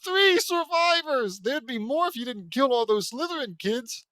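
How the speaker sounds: high, loud, mocking outrage; incredulous outburst. Real, not acted. Reader heckles the hero over three survivors, in a loud incredulous cry. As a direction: blurt it out at a high-pitched yell.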